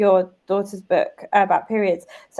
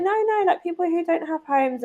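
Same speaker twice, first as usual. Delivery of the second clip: put-on higher little girl correcting mum; daughter's voice; 8 semitones higher than usual. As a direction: insist like a small child. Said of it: "And she's like".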